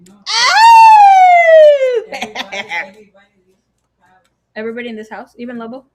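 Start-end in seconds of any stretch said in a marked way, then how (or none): 0.3-2.0 very high, loud, long-held "oh"; piercing drawn-out squeal of "oh"; mock-shocked squeal. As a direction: squeal one long high note at full volume. Teasing mom about loving daddy.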